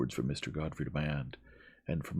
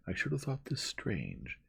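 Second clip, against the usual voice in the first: ordinary delivery, nothing marked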